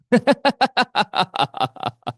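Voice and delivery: high, loud burst of laughter. Real, not acted. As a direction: laugh hard at your own joke. Laughs at own sleepy-wife story. (tertawa lepas)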